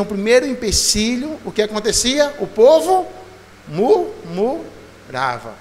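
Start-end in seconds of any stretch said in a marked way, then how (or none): 2.4-5.5 murmurava stretched very slowly, syllable by syllable; preacher drags out murmurava for the congregation to repeat; drawn-out murmurava. Shown as a demonstration. Slow, drawn-out murmurava. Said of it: "Diga assim, murmurava".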